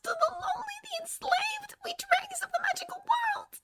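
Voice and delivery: very high, whispery house-elf squeak; a tiny frantic elf pleading; Dobby voice. A narrator's reading. Tearful house elf begging Harry Potter, high and whispery.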